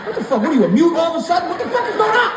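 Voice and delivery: high, loud frantic yelling; panicked outburst. Acted. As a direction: explode at a nurse who won't answer. Acted panic at silent nurse.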